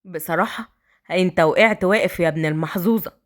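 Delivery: put-on low, cheeky young man; a rowdy brother gawking then told off; teasing brother voice. A narrator's reading. Brothers joking.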